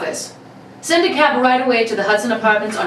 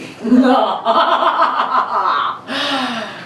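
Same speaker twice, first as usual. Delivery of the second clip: a long, triumphant crook's laugh; gloating villain laugh; 3 semitones higher than usual. Acted. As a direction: laugh at the ladies as you leave. Lone wolf signs off laughing.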